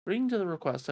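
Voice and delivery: ordinary talk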